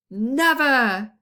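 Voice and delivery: loud defiant cry; defiant shout. A narrator's reading. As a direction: throw it back defiantly. Prince defiant reply.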